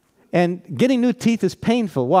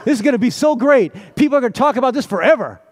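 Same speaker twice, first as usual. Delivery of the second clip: high, loud, laughing glee; giddy excitement; 7 semitones higher, 4 dB louder, faster than usual. Pretend. Preacher imagining the sisters cheering Lazarus on, laughing glee. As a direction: gush like it's the best news ever. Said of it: "Can you imagine ... Lazarus saying".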